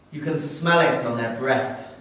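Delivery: ordinary talk, nothing marked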